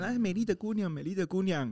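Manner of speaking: high, lightly sung-out call; dwarfs waking her. Retold or imitated. Dwarfs wake the fainted princess with a high call. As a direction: coax the sleeping beauty awake, playfully. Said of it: "说".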